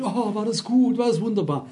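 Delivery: put-on admiring voice; mock praise. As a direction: mimic someone heaping on compliments. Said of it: "wenn mich jemand lobt".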